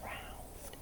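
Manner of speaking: breathy whisper, drawn out slowly; whispered refrain. Acted. Whispering the refrain to the children. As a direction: hush it like a secret.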